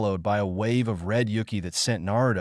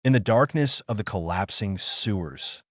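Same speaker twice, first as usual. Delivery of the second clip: ordinary delivery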